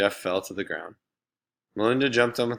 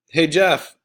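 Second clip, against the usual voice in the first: higher, louder call across the street; shout; 8 semitones higher, 9 dB louder than usual. Acted. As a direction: hail him from across the road. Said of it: "Laurie shouted".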